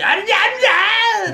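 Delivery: high, drawn-out mock yell; mom yelling. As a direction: screech wordlessly like a furious mother. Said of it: "like, the mom yelling".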